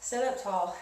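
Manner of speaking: ordinary delivery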